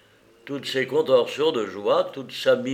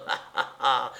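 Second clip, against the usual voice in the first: mad laugh. Acted. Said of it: "c'est à crever de rire".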